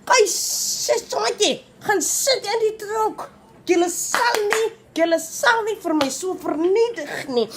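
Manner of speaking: defiant retort. Retold or imitated.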